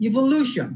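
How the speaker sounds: Evolution stretched out with লিউ stressed; stress before shon. Shown as a demonstration. Teacher giving next example, evolution stressed on লিউ. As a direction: slowly, lean on লিউ in evolution. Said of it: "শন এর আগের যে সিলেবলটা".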